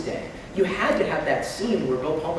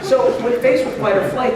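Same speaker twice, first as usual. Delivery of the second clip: usual speaking voice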